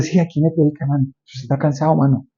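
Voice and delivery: a lightly voiced devil coaxing quietly; devil's voice. The devil talks a tired believer out of preaching, softly coaxing. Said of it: "Y el diablo dice".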